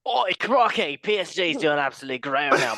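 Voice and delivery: loud, hammed-up Australian accent. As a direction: exclaim in a mock Australian accent. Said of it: "it sounded Australian".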